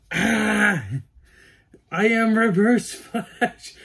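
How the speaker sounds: high, loud, slow exclamation; emotional outburst. Real, not acted. Fan in new cowl declares himself villain, emotional outburst. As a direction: cry it out, overwhelmed and thrilled. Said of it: "I'm really emotional".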